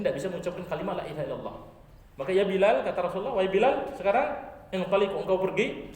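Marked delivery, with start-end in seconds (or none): 2.3-5.9 loud commanding call, higher; urgent loud call to Bilal. Acted. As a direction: summon Bilal from across the crowd. Prophet calls out to Bilal.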